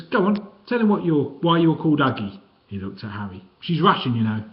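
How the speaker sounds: high, chirpy cockney voice, sniggering; cheeky little man. A narrator's reading. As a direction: play the weedy joker teasing them. Fingers voiced in story.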